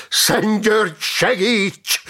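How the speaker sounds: high, loud cry to a saint; yelled battle prayer. A narrator's reading. Knight raising his sword to Saint George, yelled prayer. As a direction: yell it out as a war cry. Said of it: "üvöltötte".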